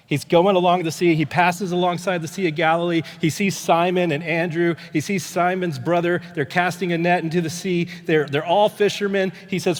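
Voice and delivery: loud, high, urgent preaching; full-force preaching. Real, not acted. Pastor to congregation about the call of the fishermen, loud preaching. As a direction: drive the passage home to the crowd.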